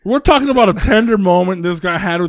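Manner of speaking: exasperated, high and rushed; incredulous scolding. Real, not acted. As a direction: scold a friend for spoiling a tender moment. Mock scolding over the chicken joke.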